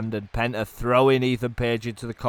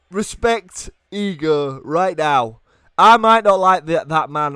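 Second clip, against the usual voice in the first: loud, raised, forceful declaration; emphatic insistence; 5 semitones higher, 7 dB louder, slower than usual. Real, not acted. Commentator urging fans to respect Ego, emphatic. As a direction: demand respect for the wrestler, punching words. Emphatic praise after near fall.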